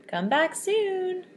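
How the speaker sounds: soft, slow farewell call; fond farewell. Acted. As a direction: draw out a fond goodbye. Unicorns say goodbye.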